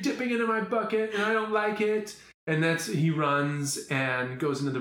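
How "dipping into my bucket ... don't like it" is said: put-on higher kid voice, lightly done; a hint of a hurt little boy; son's complaint. Son telling dad off, lightly voiced kid. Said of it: "He's like".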